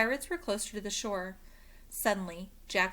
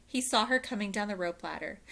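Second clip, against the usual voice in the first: plain reading voice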